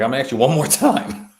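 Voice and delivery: hammed-up stern warning; mild mock menace; mock threat. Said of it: "He's like".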